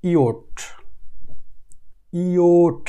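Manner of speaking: ইওট said as ই, then ও, then a final ট; ইওট sounded out for learners; German J sound. Shown as a demonstration. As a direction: say ইওট slowly with each sound clear. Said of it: "জের উচ্চারণ ... একদম আলাদা".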